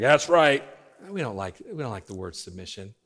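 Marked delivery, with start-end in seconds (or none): 0.0-0.6 approving parents. Acted. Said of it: "All the parents are like".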